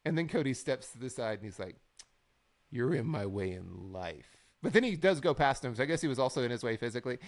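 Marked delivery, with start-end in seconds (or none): 2.7-4.4 low, slow mock-dramatic line; imitating a self-serious wrestler; slow trash talk. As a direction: draw it out to a weighty finish. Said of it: "slow talking back and forth".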